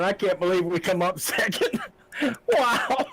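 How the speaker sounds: high, giddy amazement breaking into laughter; thrilled, high-pitched wonder; laughing disbelief. Real, not acted. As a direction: laugh through your astonishment. Racer stunned by second place, laughing.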